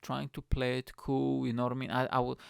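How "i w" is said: plain reading voice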